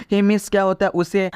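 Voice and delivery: ordinary talk, nothing marked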